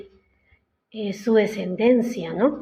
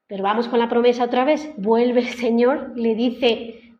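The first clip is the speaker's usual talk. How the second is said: ordinary talk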